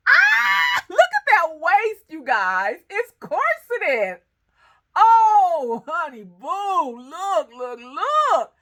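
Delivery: high, loud delighted shouting; very high, loud squealing delight; excited squeal. Real, not acted. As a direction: gush over the pattern like a fan. Gushing over corset pattern.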